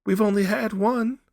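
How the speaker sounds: high, loud insistence; wife urging her husband in the dark; urgent. A narrator's reading. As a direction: press him hard.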